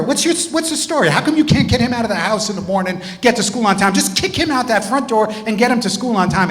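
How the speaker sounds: high-pitched, scolding rant; acted-out exasperated tirade; railing. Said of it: "I started railing into her".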